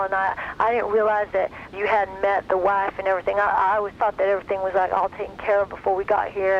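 lightly put-on Texas teen accent, earnest; imitating his cute Texas accent. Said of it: "I'm gonna imitate him 'cause he's got the cutest accent".

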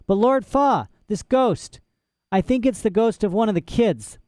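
put-on a young girl pitched high and urgent; girl pressing her case eagerly; child pleading with Lord Fa.

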